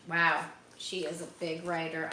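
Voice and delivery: ordinary delivery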